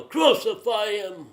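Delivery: loud, high, drawn-out mob shout; crowd yell. Acted. The people yelling at Pilate, loud drawn-out shout. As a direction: yell it out slowly like an angry crowd. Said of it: "then the people yell".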